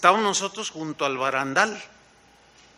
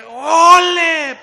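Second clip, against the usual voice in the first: high, very loud drawn-out shout; bullfighter's olé; 9 semitones higher, 11 dB louder than usual. Retold or imitated. Bullfighter calling out as the bull charges, shouting. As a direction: belt out a long olé like a matador. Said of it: "Y el torero".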